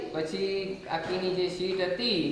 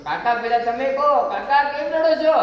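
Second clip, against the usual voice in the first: put-on insisting boy; 6 semitones higher, 6 dB louder than usual. Boy insists uncle answer first.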